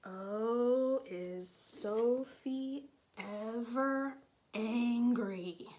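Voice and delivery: usual speaking voice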